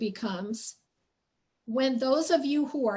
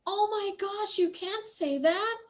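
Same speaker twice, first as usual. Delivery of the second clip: aghast family; 8 semitones higher than usual. Retold or imitated. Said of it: "they were all aghast".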